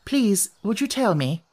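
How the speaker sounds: a tentative, polite plea; timid request. A narrator's reading. Alice begins asking the Red Queen, timidly. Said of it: "looking timidly at the Red Queen".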